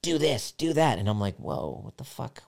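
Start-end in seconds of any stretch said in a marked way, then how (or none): 0.0-1.0 high-pitched mock bossy commands; snappy, higher-pitched nagging imitation; snapping orders. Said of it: "she's just snapping at little things". 1.3-2.4 soft retold disbelief; mutedly stunned, voice dropped; quiet, startled aside; taken aback. Retold stunned reaction to orders.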